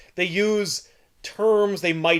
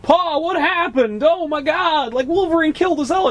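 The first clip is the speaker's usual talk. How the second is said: put-on panicked Hulk kid; 10 semitones higher than usual. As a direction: play a distressed kid calling to Pa. Retold Hulk kid's panicked cry.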